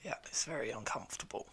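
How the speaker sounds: strained, whispery with a sore throat; hoarse sick voice. Real, not acted. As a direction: talk through a frog in the throat. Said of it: "Sound like you have a frog in your throat".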